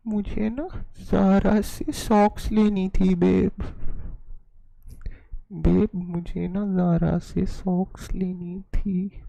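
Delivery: put-on slow, whiny girlfriend voice asking babe; mock girlfriend pleading. Streamer imitating a girlfriend begging her boyfriend for socks. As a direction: wheedle like a needy girlfriend.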